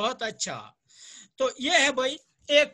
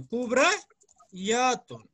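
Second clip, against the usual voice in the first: slow demo; much slower than usual. Shown as a demonstration. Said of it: "इसकी जमा बनेगी कुबरायातुन".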